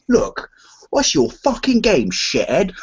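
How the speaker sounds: high, slow bad Cockney snarl; a mock Cockney threat in a bad Cockney accent. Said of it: "a really bad Cockney voice".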